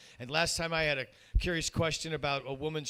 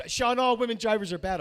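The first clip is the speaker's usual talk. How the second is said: higher, louder mock mom lecturing; imitating mom; 6 semitones higher, 5 dB louder than usual. Mom scolding her son about drivers, mock imitation. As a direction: play his mom laying down the law. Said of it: "and she goes".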